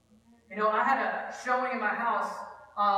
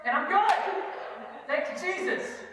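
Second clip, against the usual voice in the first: higher-pitched breezy mock piety; mock self-satisfaction; 6 semitones higher, slower than usual. Preacher mimicking a five-minute flyby believer, mock self-satisfied. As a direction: toss it off as if devotions are done. Mocking a quick, showy devotion.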